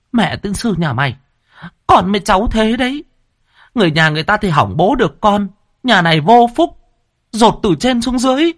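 high, loud, bitter cursing; choked fury. A narrator's reading. Mother curses her son as he rides off, high and furious. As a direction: spit out the curse through anger. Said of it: "uất nghẹn".

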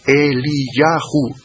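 Eliyahu drawn out slowly, E-li-ya-hu; slow Hebrew pronunciation. Shown as a demonstration. Teacher demonstrating the Hebrew form of Elías, slowly. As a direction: sound out Eliyahu carefully. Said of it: "Pues se pronuncia de esta forma".

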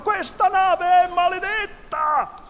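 high, loud, drawn-out crew cry; shouted outcry. A narrator's reading. Pirates take up the cry one after another, shouting. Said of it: "i pirati gridarono".

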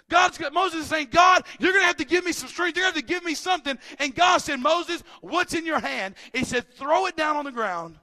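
full-throated pulpit delivery; preaching at full force. Acted. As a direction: preach it to the back row.

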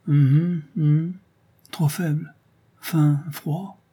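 a hint of a weak, failing voice; faint voice. A narrator's reading. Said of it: "Sa voix n'était qu'un souffle". (voix faible, à peine esquissée)

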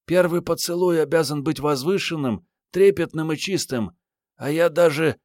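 put-on high girl's voice, lightly breathless murmur at normal volume; breathless young woman. A narrator's reading. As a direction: protest in a high female voice. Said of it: "задыхаясь, пробормотала". (высокий девичий голос, слегка задыхаясь)

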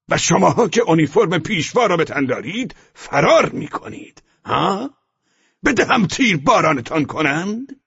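high, loud roaring officer. A narrator's reading. SS officer roaring at the boys, threatening execution. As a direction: bellow the threat at full force. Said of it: "صدایش را تا حد عربده بالا برده بود".

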